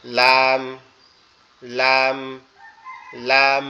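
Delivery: লাম called out clearly and loud; Arabic letter lam; letter lam demonstrated. Shown as a demonstration. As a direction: repeat লাম firmly for learners. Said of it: "এটার নাম হচ্ছে".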